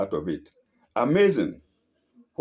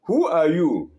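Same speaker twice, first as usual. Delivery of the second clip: higher, louder call into the mountains; curious yell; 6 semitones higher, 5 dB louder than usual. A narrator's reading. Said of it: "Curious, he yells".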